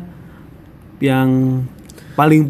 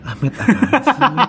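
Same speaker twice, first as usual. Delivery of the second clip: laughing; 7 semitones higher, much slower than usual. Real, not acted. Teasing a friend's story.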